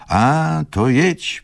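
high-pitched, airy advice; old gentleman advising. A narrator's reading. Tomasz advising his guest to go, high voice. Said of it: "poradził mu pan Tomasz".

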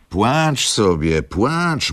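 put-on an irritated old woman scolding her weeping daughter. A narrator's reading. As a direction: shake a finger after her. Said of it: "mówiła, grożąc za nią, rozdrażniona staruszka".

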